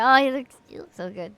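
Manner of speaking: gushing. Real, not acted. Gushing over a game character.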